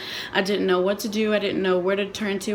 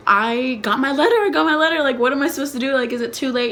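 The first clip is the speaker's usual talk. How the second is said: loud, raised-pitch flurry of excitement; retold excited panic; 4 semitones higher, 4 dB louder than usual. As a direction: blurt it like a frantic phone call. Retold frantic call about letter.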